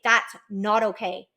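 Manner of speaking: slow, neutral voice going down; stern and firm without raising the voice; even-toned firmness. Shown as a demonstration. Coach modelling a neutral voice for parents, even-toned. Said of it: "You're just being even toned".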